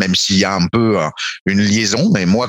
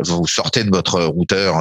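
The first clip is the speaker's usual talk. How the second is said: normal talking voice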